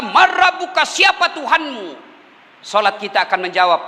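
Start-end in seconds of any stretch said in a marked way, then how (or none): 0.0-1.9 high, emphatic angelic questioning; raised, ringing interrogation; interrogating angel. Acted. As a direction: demand an answer like the questioning angel. Angel interrogates the dead in grave.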